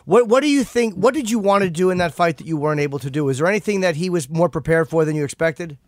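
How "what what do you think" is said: ordinary talk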